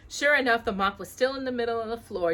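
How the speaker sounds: plain reading voice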